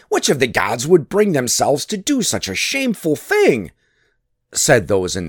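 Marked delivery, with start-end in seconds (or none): 0.1-3.7 higher, indignant question; affronted council. A narrator's reading. Council balking at Loki's shameful plan, affronted. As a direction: protest as a scandalized assembly.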